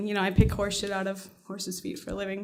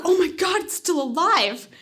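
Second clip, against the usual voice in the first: higher, amazed realization; retold astonishment; 7 semitones higher than usual. Finder sees the dead kitty breathe, acting astonished. Acted shock at breathing cat.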